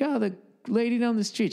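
put-on high, innocent child voice; innocent kid answer. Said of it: "I was like".

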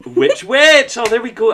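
high, loud burst of delight; thrilled punning shout; delighted exclamation. Real, not acted. Host delighted by the sudden pun.

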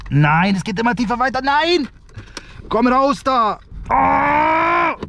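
high frantic yelling; panicked shout. Real, not acted. Bean slips deeper, panic.

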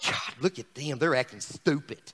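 low, quiet grumble of disapproval; mock grumpy God complaining low; disapproving God. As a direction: mutter it under your breath, disgusted. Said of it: "God's not up here going".

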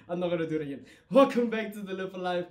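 ordinary talk

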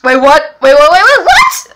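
panicked double-take that shoots upward; shocked disbelief. Acted. Shocked at no pulse.

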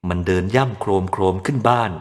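ordinary delivery, nothing marked

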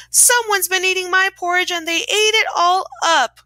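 high, hammed-up little bear wail; crying Baby Bear. A narrator's reading. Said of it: "cried the Baby Bear".

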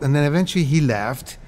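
ordinary talk

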